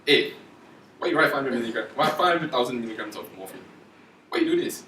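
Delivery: mock blunt telling-off; rude challenge. Shown as a demonstration. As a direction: demand an explanation, rudely. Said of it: "that's more like a rude way to say it".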